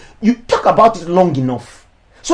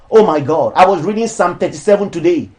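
usual speaking voice

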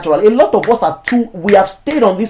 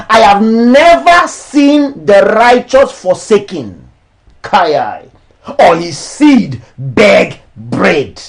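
raised, declamatory sermon voice; preaching; 3 semitones higher, 4 dB louder, slower than usual. Acted. Pastor preaching against charging for ministry, declamatory. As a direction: preach it to the church, each line landing.

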